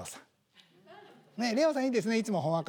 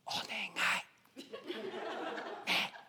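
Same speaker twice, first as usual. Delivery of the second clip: very slow, much softer plea; soft pleading whisper; 9 dB quieter, much slower than usual. Shown as a demonstration. Whispering a request into someone's ear, soft plea. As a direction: breathe it gently into the ear. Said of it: "耳元でもって一言".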